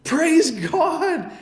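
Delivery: high, laughing burst of praise; joyful praise. Retold or imitated. Pastor answering the healed woman, laughing and exclaiming. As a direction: let it ring out with a laugh. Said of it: "What I said was".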